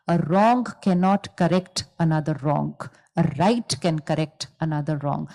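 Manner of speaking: very slow, each word weighed out; measured teacher's pace; slow, deliberate maxim. Shown as a demonstration. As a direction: spell the lesson out slowly so it sinks in.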